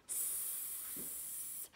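"S" as a long hissing sss; drawn-out s like a snake. Shown as a demonstration. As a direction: hiss "S" like a snake. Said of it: "sounds like a snake".

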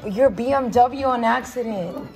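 ordinary talk

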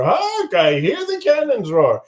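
Hark jumps way up, then the line; stage-actor flourish on Hark; rehearsing the line with a grand Hark; hammed-up Hark. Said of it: "He's practicing".

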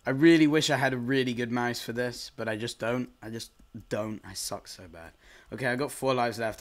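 usual speaking voice

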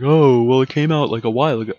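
loud, whiny know-it-all complaint; mock a nitpicking commenter; whiny complaining viewer. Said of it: "don't complain that you're like".

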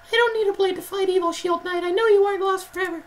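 very high, quick squeaky hero; high-pitched little knight; knight's character voice. Acted. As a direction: answer in a speedy falsetto.

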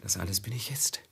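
quick, hushed confession; whisper. Acted. Master whispering his confession to Velid. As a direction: admit it under your breath.

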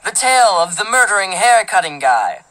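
hammed-up horror trailer voice; mock horror announcer. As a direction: announce a lurid tale with playful menace. Spoof horror title announced.

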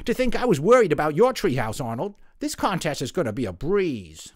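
loud, high mocking laugh into smug words; gloating laugh. Jimmy laughing at Arnold's collapsed treehouse, gloating. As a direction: crow over the rival's fall. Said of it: "Jimmy laughed".

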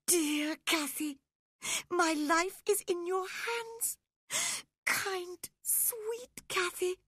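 put-on very high, tearful boy's whimper; sobbing plea. A narrator's reading. Linton begs Cathy to save him, sobbing in terror. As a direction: beg in a high wail, gasping between phrases. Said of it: "he sobbed".

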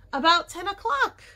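high, loud exclamation; excited cry. A narrator's reading. Jessie remembering the night noise, excited cry. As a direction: call it out on realising. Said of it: "cried Jessie".

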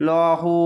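ল হু with a heavy, rounded "law"; thick L. Shown as a demonstration. Teacher reading the word after correcting it, thick heavy ল. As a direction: make the ল full and dark in ল হু. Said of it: "মোটা করে".

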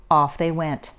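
ordinary delivery, nothing marked

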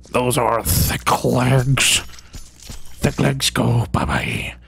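slow, drawn-out croaky whisper; silly whispery voice. Pretend. Player saying goodbye to thick legs, silly whisper. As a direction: stretch it out, sinking into a whisper.

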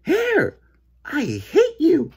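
high, loud outburst at the hair; angry yell. A narrator's reading. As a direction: yell it slowly and furiously in a high voice. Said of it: "he got mad and he yelled".

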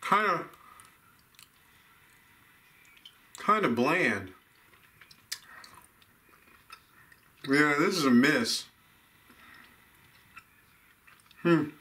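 chewing while talking; speaking around candy bite; mouth full of candy. Real, not acted. Seen 1: Bites candy pieces at 1.7–5.1, then chews while talking.

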